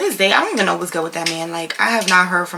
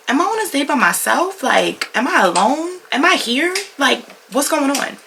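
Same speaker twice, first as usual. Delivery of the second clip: higher, bewildered questioning; exasperated disbelief; 7 semitones higher than usual. Retold or imitated. Incredulous questioning on a bad date.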